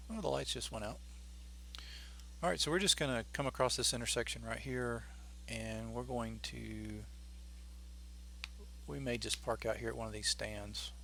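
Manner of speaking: normal talking voice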